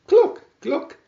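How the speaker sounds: high, loud hen cluck. A narrator's reading. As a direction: cluck it out like a proud little hen. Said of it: "called the little red hen".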